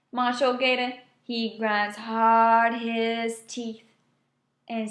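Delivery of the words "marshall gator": plain reading voice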